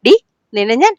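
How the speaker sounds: loud, cross threat; angry scolding. Acted. Mother threatens her cheeky little girl, cross and loud. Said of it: "Mithu ദേഷ്യപ്പെട്ടതും".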